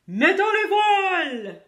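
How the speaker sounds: high, slow, loud cheer with a laugh; crying out in chorus. A narrator's reading. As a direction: shout it gleefully as a crew. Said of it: "avons-nous crié en chœur".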